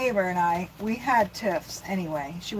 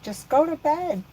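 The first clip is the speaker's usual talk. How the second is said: retold yell; 7 semitones higher than usual. Mom to daughter having a bedtime tantrum, mock yelling. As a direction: imitate yelling without full volume. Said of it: "And I'm yelling".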